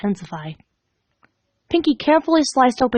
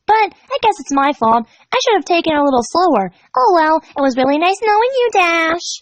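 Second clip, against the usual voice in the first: higher, sugary goodbye; sweet farewell; 6 semitones higher than usual. Acted. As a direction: coo a creepy goodbye. Pinkie farewell.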